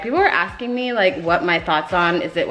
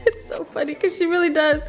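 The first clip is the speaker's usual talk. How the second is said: high, giggling through the words; laughing; 11 semitones higher than usual. Real, not acted. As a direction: laugh it out while telling. Laughs at dog looking like wig.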